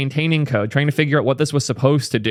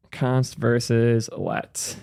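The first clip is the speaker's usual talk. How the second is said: slow; 4 dB quieter, much slower than usual. Shown as a demonstration. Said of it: "three places".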